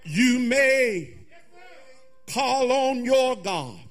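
ordinary talk, nothing marked

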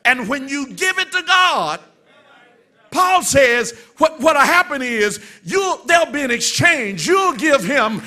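loud, high, driving sermon voice; preacher urging the church to cast off worry; preaching at full force. Acted. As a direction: push the promise out with growing fire.

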